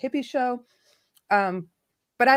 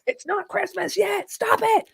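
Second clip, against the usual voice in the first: mock scolding; 10 semitones higher, slower than usual. Mock scolding about Christmas.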